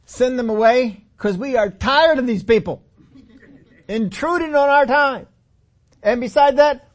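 loud, high, indignant grumble; selfish complaint. Retold or imitated. Disciples complaining to Jesus about their own hunger, loud indignant grumble. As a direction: whine that you are the hungry ones.

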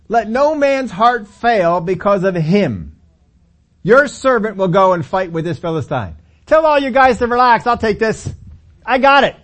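loud, high swaggering promise; cocky reassurance. Acted. David tells Saul to relax, loud and cocky. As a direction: boast you've got this.

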